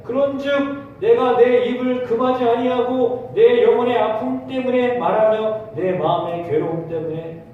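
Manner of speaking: pulpit scripture read. Acted. Reads scripture aloud.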